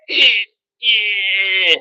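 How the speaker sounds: strained groan. Pretend. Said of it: "we're gonna be like".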